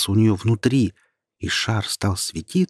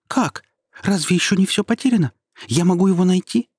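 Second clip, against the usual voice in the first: excited; 10 semitones higher, 4 dB louder than usual. Acted. Star suddenly hopeful.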